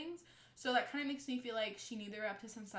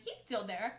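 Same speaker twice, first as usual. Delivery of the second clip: ordinary delivery, nothing marked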